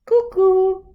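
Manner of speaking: cuckoo call. Acted. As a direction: chirp it like a little bird. Child imitates cuckoo bird.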